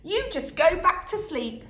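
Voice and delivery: put-on high loud small-boy voice; little boy agreeing. A narrator's reading. Youngest son agreeing, high boyish voice. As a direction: pipe up eagerly. Boy's voice.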